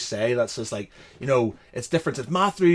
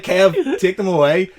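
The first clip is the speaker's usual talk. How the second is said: exasperated plea; 6 semitones higher, 9 dB louder than usual. Retold or imitated. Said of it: "He's like".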